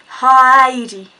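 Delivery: HEIDI loud and stretched out; drawn-out Heidi. Retold or imitated. Giving the man a fake name, HEIDI said slow and loud. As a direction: draw out the first syllable of Heidi, loudly. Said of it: "was really fast".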